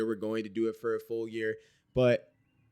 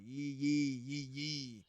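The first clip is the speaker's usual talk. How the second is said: soft, drawn-back Southern imitation; hushed mock-Southern cheer; Southern accent; 11 dB quieter, much slower than usual. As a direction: quietly put on a Southern twang. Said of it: "my best impression of a Southern accent".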